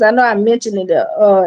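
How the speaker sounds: ordinary delivery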